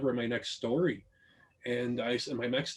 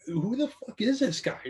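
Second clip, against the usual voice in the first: higher mock disbelief of the parents; mock shocked parents; 9 semitones higher than usual. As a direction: play the baffled parents, pitched up. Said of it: "they're like".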